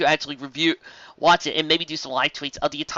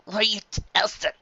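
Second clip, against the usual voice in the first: usual speaking voice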